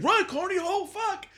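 mock panic. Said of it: "Yeah, I was like".

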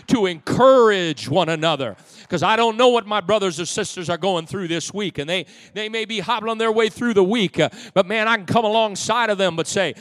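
loud, soaring sermon delivery; rising, ringing pulpit voice; full-force preaching. Acted. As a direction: preach it out to the whole room.

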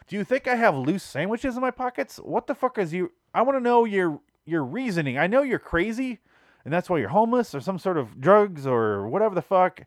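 high-pitched, incredulous tirade; worked-up comic disbelief; exasperated mock rant. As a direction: demand an explanation for the absurd request. Mock rant at sandwich request.

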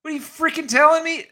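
hammed-up outrage; mock angry retort. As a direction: fling it back furiously. Outraged reenactment.

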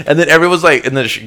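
ordinary talk

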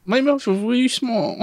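put-on high squeaky baby voice. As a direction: speak like a tiny child. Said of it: "this baby voice".

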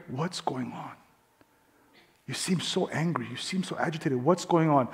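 soft, low, tender questioning; gentle concern. Acted. Christlike person asking an upset woman what's wrong, softly. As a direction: ask quietly, as if to draw her out.

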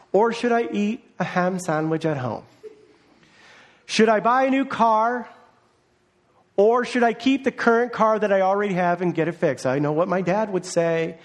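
normal talking voice

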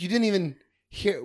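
high, indignant whine; indignant protest. Retold or imitated. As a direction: protest in disbelief. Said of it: "he's like".

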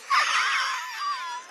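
very high, shrieking mad laugh; mad laughter. Retold or imitated. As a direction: imitate someone cackling like a lunatic. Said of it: "She's laughing like a mad".

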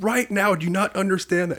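retold drill instructor screaming at a recruit; drill instructor shout. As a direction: bellow it like an enraged drill sergeant, imitated.

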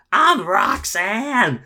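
put-on high, loud, slow drunk drawl; drunk woman. Drunk woman introducing herself to the teller, high and slow. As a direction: stretch out the name like a tipsy stranger.

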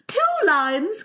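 high-pitched eager outburst; excited cry. Acted. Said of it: "cried the Mock Turtle".

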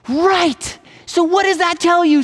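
high-pitched, emphatic vindication; triumphant doctor. Acted. Doctor thinks he has convinced the patient, triumphant. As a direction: pounce on it as if the point is finally proven.

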